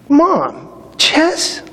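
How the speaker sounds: high, incredulous "chess?" nearly whispered; stunned boy, can't believe it; retold disbelief. Boy stunned by doctor's advice.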